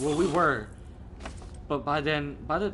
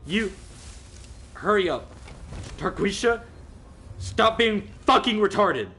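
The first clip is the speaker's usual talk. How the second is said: retold angry scolding; 6 semitones higher, 3 dB louder than usual. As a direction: act out fed-up scolding over the phone. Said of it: "I'm getting mad".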